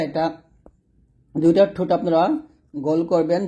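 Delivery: ordinary talk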